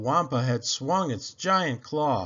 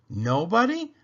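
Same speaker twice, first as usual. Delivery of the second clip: a sharp rising "Nobody?", higher; alarmed question; 6 semitones higher, 4 dB louder than usual. Acted. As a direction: caught off guard, pitch climbing. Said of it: "Han asked".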